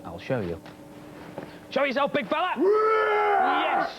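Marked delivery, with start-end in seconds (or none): 1.7-3.9 excited play-by-play. Acted. Host cheering the dog race, excited commentary. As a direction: call the race, cheering them on.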